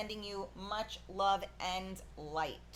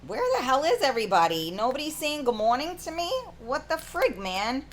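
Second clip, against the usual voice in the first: loud, high mock complaint; mock indignation; 4 semitones higher, 7 dB louder than usual. Host asking viewers where everybody is, mock indignant. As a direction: call out loudly, pretending to be put out.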